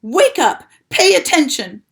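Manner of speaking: loud, high urgent yells; screaming. A narrator's reading. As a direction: rouse him with a yell. Wilbur screaming at rat.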